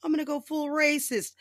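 mock Trump. Mocking Trump's attack plans to viewers, high and loud. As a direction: blurt it like a proud Trump. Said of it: "without just being like".

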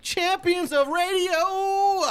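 booming ringmaster announcer, stretched out. Acted. Wrestling-style show intro.

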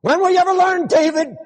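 high, loud exasperated scolding; mock a fed-up parent scolding a child; scolding God. God imagined scolding David.

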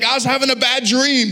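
high imitated wife's protest; high, exasperated mock wife; indignant protest. Wife protesting after waking.

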